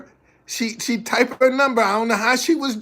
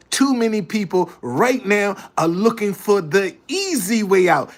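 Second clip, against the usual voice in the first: ordinary talk, nothing marked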